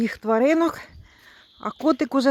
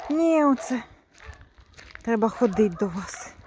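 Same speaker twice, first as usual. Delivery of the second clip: usual speaking voice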